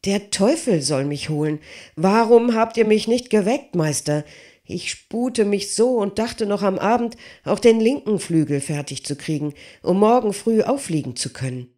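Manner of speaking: agitated smith. Acted. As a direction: rush it out, upset and pleading.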